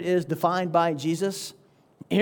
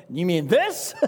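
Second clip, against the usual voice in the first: mock innocent. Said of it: "To which you say".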